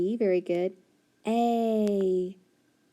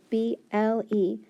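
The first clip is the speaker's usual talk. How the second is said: normal talking voice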